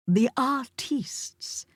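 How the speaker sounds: "artistes" drawn out slowly in a hammed-up French way, ar-TEEST; exaggerated French accent. A narrator's reading. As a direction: say "artistes" the French way, stretched and showy. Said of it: "pronounces it with an exaggerated accent".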